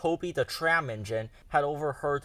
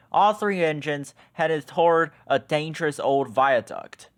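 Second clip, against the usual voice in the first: plain reading voice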